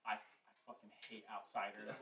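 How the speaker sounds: low-volume grumbled mutter; muttered aside from a grumbling old man; muttering under breath. Acted. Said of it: "muttering, just, like, under his breath".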